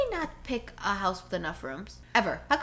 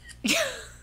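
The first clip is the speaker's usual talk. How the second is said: high giggle. Real, not acted. Laughing at friend's modeling.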